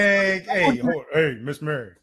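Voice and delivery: big loud booming announcer call; booming bass voice. Shown as a demonstration. Host asks him to boom out his greeting to Mary. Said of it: "Put that bass in your voice".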